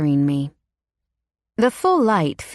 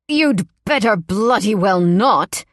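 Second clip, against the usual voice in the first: slow, louder, spat-out threat; spitting anger; 4 semitones higher, 5 dB louder than usual. Acted. Boss snapping at the keeper, spat out angrily. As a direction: spit it at her, cold. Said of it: "He spits in that ice-cold accent".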